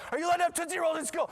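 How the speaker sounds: high, loud, rapid-fire interrogation; imitated scolding teacher. Teacher questioning girl about Tootsie Rolls, mock shouted scolding. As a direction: shout it fast like an outraged teacher. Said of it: "the teacher's like".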